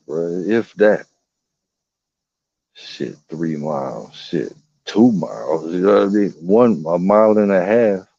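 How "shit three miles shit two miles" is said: normal talking voice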